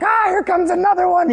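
freaked out. Retold or imitated. Disciples in boat as waves rise, freaked out. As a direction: imitate panicked sailors facing a wave. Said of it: "they're freaked out".

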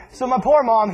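ordinary talk

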